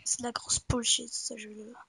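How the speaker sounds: unvoiced grumbling whisper. Real, not acted. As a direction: whisper the complaint. Repeats the complaint in a whisper.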